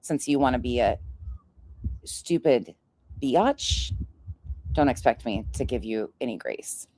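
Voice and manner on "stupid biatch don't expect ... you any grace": ordinary delivery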